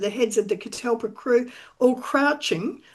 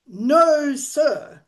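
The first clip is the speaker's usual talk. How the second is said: firm No, a bit louder; decisive; 4 semitones higher, 4 dB louder than usual. Acted. Captain Anthony refuses Stone once more, decisive. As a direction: shut him down decisively. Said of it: "Anthony said decisively".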